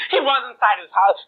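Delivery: ordinary delivery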